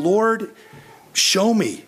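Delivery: retold desperate prayer, voice lifted; pleading prayer. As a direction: beg the Lord for a sign, pitch up high. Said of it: "And I was saying".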